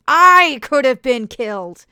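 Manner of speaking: slow, very loud furious shout. A narrator's reading. Alice screams at Oswin after the near crash, furious. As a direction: explode at him. Anger flooding her face.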